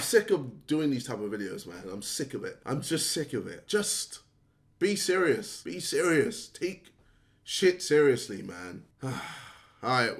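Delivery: soft, low and tired; weary plea. Real, not acted. [name] tiredly begging [name] to get serious. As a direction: plead with him wearily.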